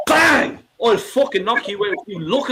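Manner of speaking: sudden loud Bang! exclamation; mock punch yell. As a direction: bark it out like throwing a punch. Acting out a punch.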